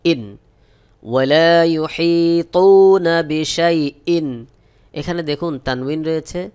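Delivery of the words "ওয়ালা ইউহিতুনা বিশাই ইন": slow chanted recitation; teacher models the verse in slow tajweed; Quranic recitation. Shown as a demonstration. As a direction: recite it slowly and melodiously.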